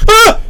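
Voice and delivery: sudden high, loud shriek; startled scream. Acted. Game master screams as lights click on, startled shriek. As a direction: yelp sharply, very high.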